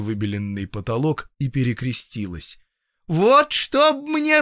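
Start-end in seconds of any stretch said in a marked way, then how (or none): 3.1-4.4 very high, slow, loud oath; protesting peasant woman. A narrator's reading. Patient crossing herself and swearing innocence, protesting. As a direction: wail out a solemn oath.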